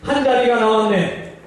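high, archaic commanding tone; old-fashioned MC. Acted. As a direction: play a theatrical game host. MC orders in an archaic style. (사극풍 진행자 말투)

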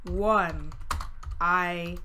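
slow, word by word; writing along. Real, not acted. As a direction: spread the words out as you write them. Speaking along while writing notes.

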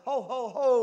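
loud, high ho-ho-ho; jolly mock laugh. A narrator's reading. Second pig laughing at his busy brother, loud mock laugh. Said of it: "laughed the second little pig".

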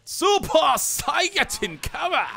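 high, slow loud battle cry; slow, high Super Saiyan yell; powering-up yell. Pretend. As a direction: scream it like powering up. Powering up announcement.

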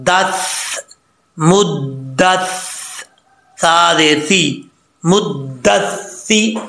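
syllable by syllable up to a slow मुद्दस्सिरू; spelling-out recitation. Shown as a demonstration. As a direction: hold each doubled consonant of मुद्दस्सिरू. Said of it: "अब इसको हिजे करेंगे".

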